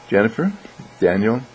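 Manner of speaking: usual speaking voice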